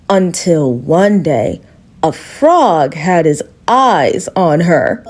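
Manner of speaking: slow, low and foreboding; ominous slow narration. A narrator's reading. Narrator hushing the children before the frog arrives, slow and low. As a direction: drop the voice to build dread.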